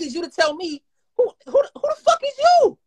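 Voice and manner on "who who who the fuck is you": angry shout. Real, not acted. Furious shout at the man telling him not to post. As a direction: blow up at the guy who lectured him. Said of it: "Is that how you talk to your mother?".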